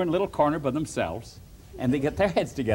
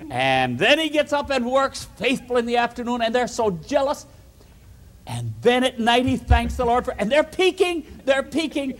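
usual speaking voice

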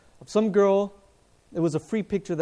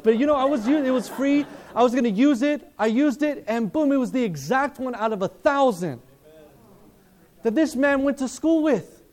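loud, higher, fervent sermon delivery; preaching at full force; 6 semitones higher, 6 dB louder than usual. Acted. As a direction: rouse the room with preaching. Preaches to the congregation.

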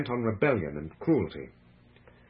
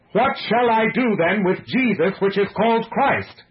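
high, loud challenge to a crowd; forceful, urgent public questioning; raised appeal; 11 semitones higher, 8 dB louder than usual. A narrator's reading. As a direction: project the question over the uproar. Pilate shouts back at crowd.

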